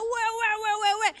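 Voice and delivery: high, repeated "weh" wails; put-on tantrum sobbing, high-pitched; mock child crying. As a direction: imitate a bawling toddler.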